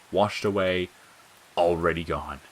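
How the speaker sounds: usual speaking voice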